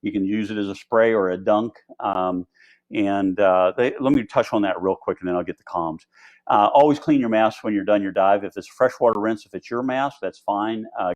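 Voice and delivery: ordinary talk, nothing marked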